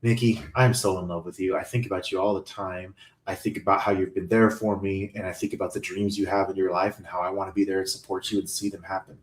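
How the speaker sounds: low, soft, sincere declaration; heartfelt confession. Pretend. Man showing how to tell Nikki he loves her, softly sincere. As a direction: confess love gently, low and hushed.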